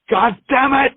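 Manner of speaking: high-pitched imitated cursing; imitating a friend's frustrated yelp; mock exasperated outburst. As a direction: cry it out high, comically fed up. Imitates friend finding the money.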